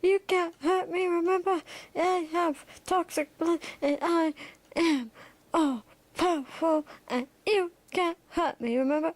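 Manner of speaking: high-pitched boastful character voice; high, self-important villain voice; Great One. Acted. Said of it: "He goes".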